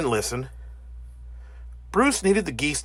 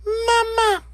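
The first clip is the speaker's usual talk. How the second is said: very high, piping chick call; baby gosling cry; 4 dB louder than usual. Acted. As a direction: squeak it like a newborn gosling. Goslings following the bear.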